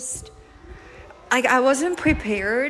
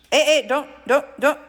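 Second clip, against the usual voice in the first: sharp, louder warning; louder scolding; 5 semitones higher, 6 dB louder than usual. Real, not acted. Owner stopping the kitten from getting into something. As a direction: stop it right now, firm and loud.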